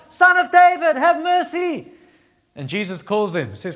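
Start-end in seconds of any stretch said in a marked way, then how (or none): 0.1-1.9 much higher, loud desperate shout; crying out louder. Retold or imitated. Blind men crying out louder to Jesus. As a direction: call over the crowd hushing you. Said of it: "they cry out louder".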